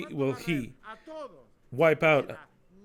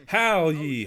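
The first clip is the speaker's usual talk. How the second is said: louder, drawn-out "Howl ye" as a cry; 2 semitones higher, 6 dB louder than usual. Shown as a demonstration. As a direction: call out "Howl ye" like a wail. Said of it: "don't yell, howl".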